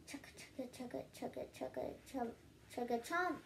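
soft breathy chugga eating noises ending in a chomp; gobbling noises. Acted. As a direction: make the sound of wolfing food down. Gobbling food sound.

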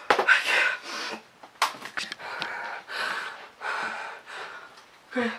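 breathless unvoiced whisper; frightened whisper. Real, not acted. Apologizing to viewers in the dark, scared whisper.

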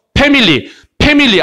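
"패밀리" starting with a popped P; P instead of F. Shown as a demonstration. Teacher mimicking Korean learners' mistake, "패밀리" with P. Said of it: "이걸 P로 발음해서".

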